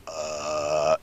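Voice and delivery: low strained groan; mock awkward groan. Guest acts out sitting through an awkward family moment with a low strained groan. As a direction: let out an uncomfortable groan.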